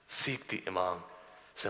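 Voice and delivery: ordinary delivery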